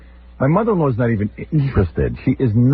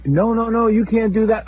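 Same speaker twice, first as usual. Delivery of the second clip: high pleading protest; imitating wife protesting; 11 semitones higher than usual. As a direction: beg him not to go, pitched up.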